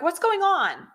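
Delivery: high, caring "What's going on?" asked up top; a touch of surprised concern; concerned question. Retold or imitated. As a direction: ask it like you just noticed someone hurting. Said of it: "saw this woman and was like".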